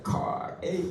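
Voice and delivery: hushed, rising surprise; pleasantly surprised. Shown as a demonstration. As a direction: spot your favorite car, softly amazed. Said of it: "it kinda surprises you".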